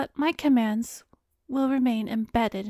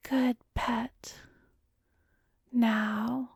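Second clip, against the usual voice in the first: whisper; much slower than usual. Acted. Praising entranced subject.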